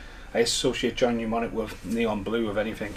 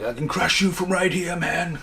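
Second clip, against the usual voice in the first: high, full-on movie hero impression; Keanu Reeves; 6 semitones higher than usual. Quoting the film hero threatening to crush someone, full-on actor impression. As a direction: imitate an intense film star, pitched well up. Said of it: "he goes full on Keanu Reeves".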